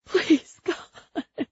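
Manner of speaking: high, thin sob fading away; sobbing prayer. A narrator's reading. Principal's wife sobbing into her hands, sobbing prayer. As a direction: plead to God through tears. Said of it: "Missus Pinkman sobbed into her hands".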